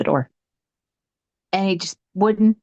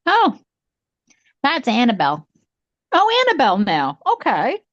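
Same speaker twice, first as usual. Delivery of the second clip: a light, higher breezy stepmother voice; imitating stepmother; 7 semitones higher than usual. Stepmother casually naming the ghost at breakfast, lightly mimicked. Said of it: "She was like".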